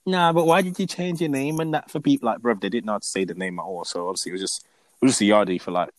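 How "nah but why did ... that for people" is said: imitating critics. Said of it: "some of the people are gonna be like".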